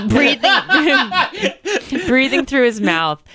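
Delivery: high, breaking into laughter; laughing while reading. Real, not acted. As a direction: laugh through the words.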